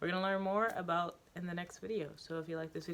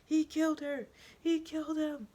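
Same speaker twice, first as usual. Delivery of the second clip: very high, loud frantic yells; yelling; 12 semitones higher, 6 dB louder than usual. Acted. The student yelling after the attack. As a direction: scream it in panic. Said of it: "he yells".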